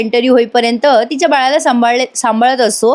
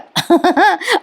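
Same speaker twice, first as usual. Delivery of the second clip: short high-pitched laugh; amused laugh; 8 semitones higher than usual. Real, not acted. Narrator tickled by the drawing book scene, amused laugh. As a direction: laugh briefly, pitch jumping up.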